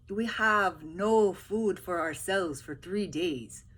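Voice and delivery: Paul refusing the soldiers food. Acted. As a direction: plead poverty slowly.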